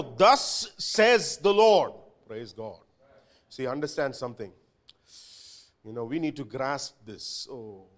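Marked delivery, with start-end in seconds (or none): 0.0-1.9 slow, weighty proclamation; proclaiming. Acted. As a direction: declare it like a prophet. Preacher proclaiming God's word.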